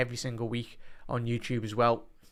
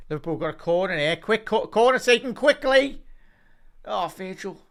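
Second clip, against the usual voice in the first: excited call; 8 semitones higher, 7 dB louder than usual. Real, not acted. Calling a quick corner live.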